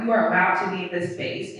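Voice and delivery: ordinary talk, nothing marked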